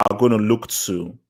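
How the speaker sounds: ordinary talk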